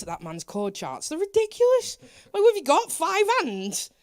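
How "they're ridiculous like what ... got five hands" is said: high, loud incredulous rant; exasperated. Real, not acted. Guest ranting about Paul's chord charts, exasperated. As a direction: protest in rising disbelief, much louder.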